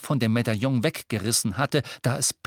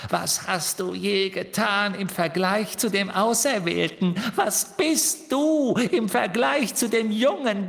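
higher, cackling sneer; taunting Riddle-Hermine; 8 semitones higher than usual. Acted. Riddle-Hermine mocking Ron against Harry, high cruel sneer. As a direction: belittle him cruelly.

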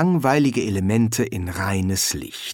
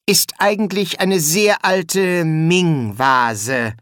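slow, raised-pitch explanation; deliberate bursar; 5 semitones higher, slower than usual. Acted. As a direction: explain it slowly and importantly.

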